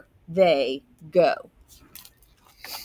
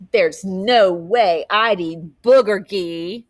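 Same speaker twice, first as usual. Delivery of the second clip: slow, louder sneering taunt; mocking; 4 dB louder, slower than usual. Classmate mocking Justin's lunch, slow and sneering. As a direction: drag it out to tease him. Kid mocking new student's food.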